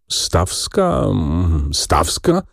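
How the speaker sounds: musing hum between questioning repeats; trying to recall. A narrator's reading. Clerk trying to place a woman's name, musing. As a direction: search your memory aloud, hesitantly. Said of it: "przypominał sobie Rzecki".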